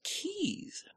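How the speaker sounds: very soft whispered reveal. Pretend. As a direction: whisper the word secretively. Whispers what will be mailed.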